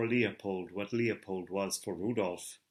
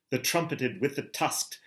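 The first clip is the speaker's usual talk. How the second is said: ordinary delivery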